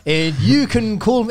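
put-on Afrikaans accent. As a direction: answer in a hammed-up Afrikaans accent. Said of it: "that's why you did the Afrikaans accent".

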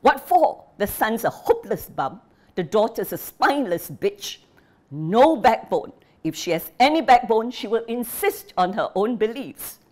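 a father disowning his daughter in fury; shouting in rage. Acted. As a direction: yell it through the house. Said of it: "shouting into the hot afternoon air".

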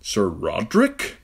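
slow, astonished question; Ned astonished to see his old knight. Acted. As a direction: ask in disbelief.

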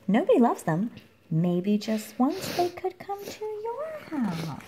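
ordinary delivery, nothing marked

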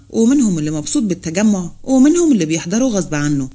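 plain reading voice

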